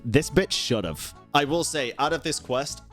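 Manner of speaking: ordinary talk, nothing marked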